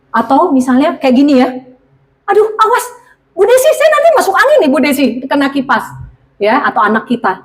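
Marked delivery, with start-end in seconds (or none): 2.2-5.8 high, fast fretting voice; fussy worried woman. Retold or imitated. A fussy woman frets to Bu Desi, high and fast. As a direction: fuss like someone scared of the fan. Mimicking someone fearing a cold.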